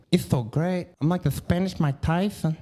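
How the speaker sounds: "so" and "Tyson" with th for s; Spanish lisp. Retold or imitated. Comedian mocking Spain Spanish, exaggerated lisp. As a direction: lisp every s, as in "Tyson" becoming "Tython". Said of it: "they talk with their lisp".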